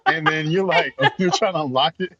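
ordinary delivery

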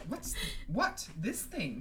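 high, mildly disgusted question; acted touch of revulsion. A narrator's reading. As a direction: ask it with distaste, pitch jumping. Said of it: "in a tone of revulsion".

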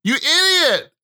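high, panicked cursing at the water; mock panicked onlooker. Husband on the beach cursing his wife's swim, panicked. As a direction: play the horrified bystander yelling at his wife. Acting out shark attack reaction.

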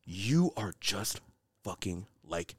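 low, soft, menacing; lightly voiced Killmonger, low and dark. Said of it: "Killmonger, who says".

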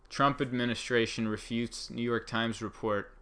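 ordinary delivery

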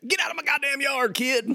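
put-on high cranky old man yelling; grumpy old man. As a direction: shoo the kids off your lawn.

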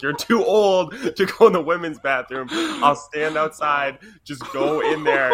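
lightly voiced firm mom; a hint of a mom insisting. Mom telling her son to use the men's room, mildly firm. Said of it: "She's like".

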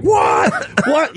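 high startled yells of what; aunt shrieking at a dead rabbit under the pillow; shocked aunt shouting. Retold or imitated. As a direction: shout it like you just found something horrid. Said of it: "she was like".

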